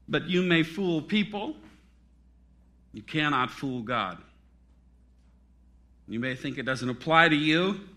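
ordinary talk

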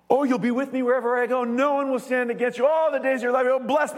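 high, fast and thrilled; gushing delight. Retold or imitated. Pastor gushing over God's promise, high and thrilled. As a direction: read the promise like a delighted fan. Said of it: "I love underlining the promises".

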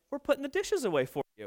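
small, high child's voice, lightly put on; four-year-old boy. Four-year-old answering his dad from the kitchen, soft little-kid voice. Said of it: "our four-year-old".

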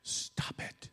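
soft, fading to a whisper; tender father. Retold or imitated. God as a father who nearly lost his child, softly. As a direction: hush it like a shaken parent. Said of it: "he says".

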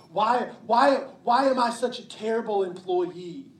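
rising into a desperate outburst of self-blame; the inner voice of condemnation spilling over into a cry; anguished self-accusation. Retold or imitated. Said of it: "feeling of".

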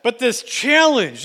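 ordinary talk

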